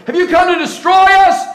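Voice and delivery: high, loud frantic shout; demon crying out. Acted. Evil spirit fearing destruction by Jesus, loud frantic shout. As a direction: scream the question at Jesus. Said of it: "cried out".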